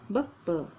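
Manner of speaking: "ब, ब।" with the first ब weak and the second ब strong; "ब, ब।" पहला ब हल्का, दूसरा ब पूरा; soft half b, then full b. Shown as a demonstration. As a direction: say "ब, ब।" as a light, clipped ब and then a full ब. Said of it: "पहले का साउंड कम आता है और दूसरे शब्द का साउंड ज़्यादा आता है".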